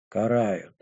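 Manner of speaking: low, soft, detached answer; indifferent. A narrator's reading. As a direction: confirm it quietly, as if it hardly matters. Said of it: "индифферентно проговорила безымянная".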